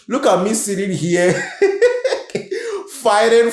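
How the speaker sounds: laughing at herself mid-sentence. Real, not acted. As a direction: break into a high laugh and keep talking through it.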